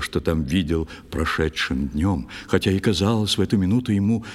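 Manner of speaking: ordinary delivery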